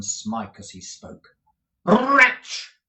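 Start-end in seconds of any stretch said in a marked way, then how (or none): 1.8-2.8 a high, loud fierce cry; fierce retort. Acted. Nicholas fiercely answers the raging Squeers. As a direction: spit the word at him fiercely. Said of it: "rejoined Nicholas fiercely".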